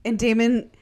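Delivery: ordinary talk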